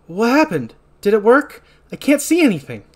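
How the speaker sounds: high, loud acted outcry; alarmed questioning. Nika asks after everyone is splattered, high alarmed cry. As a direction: call out in confused alarm. Acted quote of panicked character.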